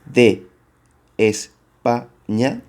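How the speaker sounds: España drawn out slowly, split into its syllables as Es-pa-ña. Shown as a demonstration. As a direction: spell out España slowly for counting. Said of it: "Siguiente verso".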